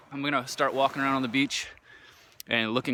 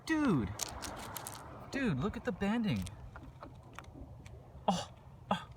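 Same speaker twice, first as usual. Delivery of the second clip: excited; 8 semitones higher, 3 dB quieter than usual. Real, not acted. Thrilled at banded agate find.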